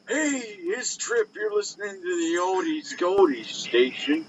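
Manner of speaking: high, slow oldies radio jock. Pretend. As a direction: croon the dedication like an old DJ. Parody oldies station DJ.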